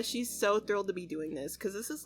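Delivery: usual speaking voice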